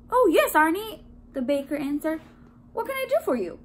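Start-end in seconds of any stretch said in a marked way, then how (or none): 0.1-1.0 loud, high, jolly recognition; hearty baker. A narrator's reading. Baker recognizes his donut on phone, hearty. 2.7-3.6 high, helpful phone voice; cheery baker. A narrator's reading. Baker asks caller what he needs, cheery.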